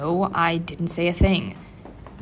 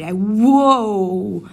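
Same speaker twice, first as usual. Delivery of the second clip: startled whoa; 9 dB louder than usual. Acted. Listener suddenly comes close.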